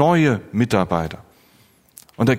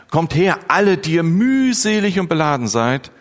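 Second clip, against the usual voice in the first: raised, higher-pitched call to come; Jesus's invitation; 6 semitones higher than usual. Acted. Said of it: "Jesus lädt dich ein".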